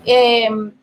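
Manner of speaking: usual speaking voice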